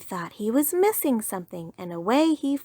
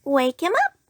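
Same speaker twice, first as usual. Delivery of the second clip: squirrel voice; 7 semitones higher, 6 dB louder than usual. Acted. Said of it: "said the squirrel".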